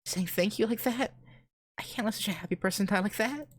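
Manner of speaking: put-on high-pitched girl's voice, no real sobs; weeping girl. A narrator's reading. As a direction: play her upset, pitched far up. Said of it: "continues to weep".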